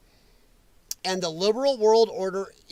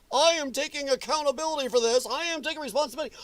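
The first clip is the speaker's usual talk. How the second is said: higher, hurried fake apology; mock official statement; 6 semitones higher, faster than usual. Streamer mimicking officials who claim accountability, mock statement. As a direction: rattle it off like an empty press statement. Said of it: "That's what they say".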